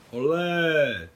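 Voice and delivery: high, loud olé on the leap; joyful yell. A narrator's reading. Mášenka leaping into the sea after Nicole, joyful loud yell. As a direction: cheer as you jump in after her. Jumping after her into water.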